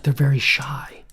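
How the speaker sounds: imitated birdwatcher's whisper; hushed whisper. Birdwatcher warning a walker about woodpeckers, hushed. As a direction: whisper it so the birds stay. Retells birdwatcher hushing him.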